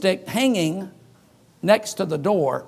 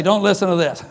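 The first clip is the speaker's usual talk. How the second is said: mock warning; 2 semitones lower than usual. Shown as a demonstration. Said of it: "Sure way to get them to listen is say".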